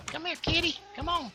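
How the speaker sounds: very high-pitched kitty call; high pet-calling voice; coaxing a cat. Real, not acted. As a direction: squeak it up high to coax a kitty over.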